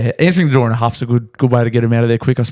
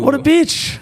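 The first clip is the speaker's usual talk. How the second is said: outraged; 13 semitones higher, slower than usual. Real, not acted. Outraged at air hostess.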